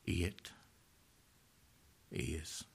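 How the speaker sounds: barely audible, fading to nothing; last breath of a dying man; dying whisper. Acted. Christ's last words, dying fade.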